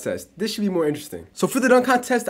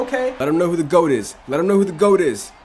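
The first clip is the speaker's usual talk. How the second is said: ordinary delivery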